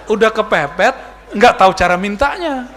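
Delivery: loud, laughing through the words. Real, not acted. Preacher teasing the congregation, laughing loudly. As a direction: laugh as you deliver the punchline.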